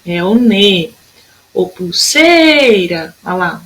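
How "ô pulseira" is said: "pulseira" called out with a very long sei; chamando, SEI esticado. Shown as a demonstration. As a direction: hold the sei of "pulseira" like a call. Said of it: "vamos chamar a palavrinha". ("pulseira" chamada com o sei bem comprido)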